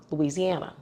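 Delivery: ordinary delivery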